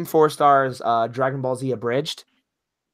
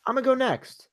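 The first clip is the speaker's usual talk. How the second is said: forceful; 12 semitones higher than usual. Real, not acted. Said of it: "Why, why do you sound so forceful?".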